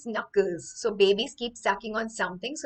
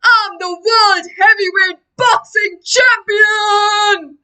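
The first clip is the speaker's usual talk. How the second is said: loud, raised, drawn-out announcer-like bellow; booming baby champion; 11 semitones higher, 12 dB louder, slower than usual. Acted. Hypnotized baby revealing his past self, booming shout. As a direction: bellow it slowly like a boxing champ. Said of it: "it has a sonorous voice".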